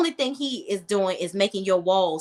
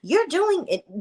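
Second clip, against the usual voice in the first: high, frantic accusing voice; rising to a much higher accusing pitch; imitating an upset woman, high and frantic; frantic accusation; 9 semitones higher than usual. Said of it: "And she's like".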